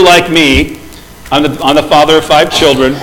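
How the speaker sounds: ordinary delivery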